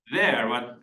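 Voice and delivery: ordinary talk, nothing marked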